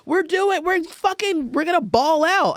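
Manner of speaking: high-pitched, giddy big-spender voice; gleeful bravado. Acted. Newly rich country going on a spree, gleeful bravado. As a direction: crow it high, like splashing new money.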